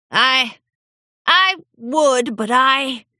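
put-on halting old pony stammer; stammering old stallion. A narrator's reading. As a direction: stammer in confusion. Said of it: "the old pony stammered".

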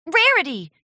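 high, loud worried call; crying out. A narrator's reading. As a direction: cry out her name in alarm. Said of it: "Charity cried out".

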